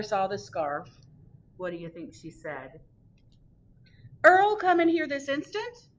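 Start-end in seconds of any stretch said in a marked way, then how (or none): 4.2-5.9 much higher and louder sharp summons; mother calling Earl in, high and loud; scolding mother. Acted. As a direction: call him in like a cross mother. Mother scolding her son.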